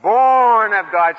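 "Born of God" said slowly and loudly, each word weighed; savoring each word. Shown as a demonstration. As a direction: dwell on "Born", slow and full. Repeating words called out by class.

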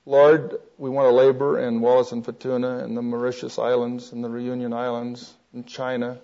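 low, soft example prayer; quiet prayer. Acted. Voices an example of written prayer.

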